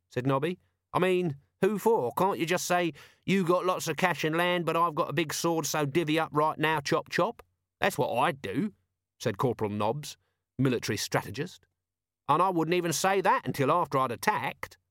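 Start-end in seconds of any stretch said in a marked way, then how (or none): 0.9-8.7 blunt corporal, reasoning simply; higher, lively character voice; Nobby. Acted. 12.3-14.8 blunt corporal, reasoning simply; higher, lively character voice; Nobby. Acted.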